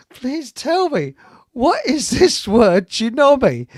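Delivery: high, slow, pleading officer; German officer. Retold or imitated. Captured German officer begging to know the word, slow imitation. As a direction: imitate a baffled officer, drawn out and loud. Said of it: "captured a senior bloke in the German army".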